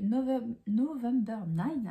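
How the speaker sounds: self-mocking English accent on the title, slow and loud. Real, not acted. Booktuber says the book title in her English accent. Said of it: "avec mon super accent anglais".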